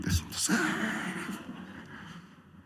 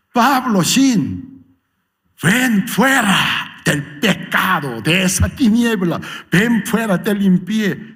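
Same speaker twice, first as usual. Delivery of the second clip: high, slow, loud divine call; a commanding call from on high; 8 semitones higher, 4 dB louder, slower than usual. Acted. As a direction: summon him out of the darkness, slow and loud. Jesus calling the sinner out.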